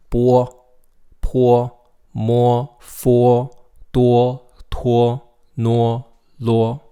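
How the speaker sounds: "Bo" tot "lo" luid en nadrukkelijk, elk met u-glijder; Pinyin syllables. Shown as a demonstration. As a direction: lettergrepen luid oplezen, u eerst dan o. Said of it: "they both sound like wo".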